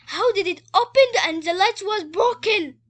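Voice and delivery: high, loud exclamation; astonished shout. A narrator's reading. Storyteller to listeners at the twist, astonished shout. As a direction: shout the mystery out in disbelief.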